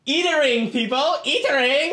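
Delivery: high-pitched vendor-like call; yelling out. Acted. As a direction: call the crowd over to the food. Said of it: "I yell out from my crowd".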